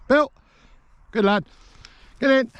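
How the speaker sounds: very high praise and command; high calling to dog. Real, not acted.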